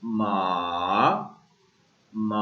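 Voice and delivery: मा low and dipping. Shown as a demonstration. Teacher reviewing the third tone. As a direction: sink मा low then rise. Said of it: "third tone".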